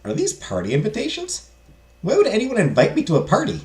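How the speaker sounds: puzzled madame. Acted. Player voices surprised madame.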